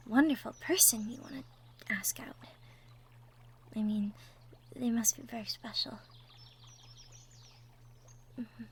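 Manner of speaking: gentle, hushed ASMR character voice, often whispering; soft-spoken roleplay girlfriend. Acted. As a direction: talk softly to the nervous listener.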